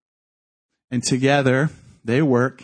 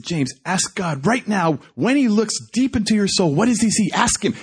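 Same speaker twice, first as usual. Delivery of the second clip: higher-pitched, pressing command; urgent urging; 6 semitones higher than usual. Retold or imitated. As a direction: act out pushing him to ask. Preacher urging prisoner in retold exchange.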